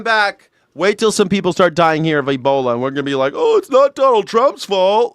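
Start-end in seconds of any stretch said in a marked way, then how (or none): 3.3-5.1 high, slow mock protest; mocking excuse. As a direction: parody a defender making excuses. Mocking future excuses for Trump.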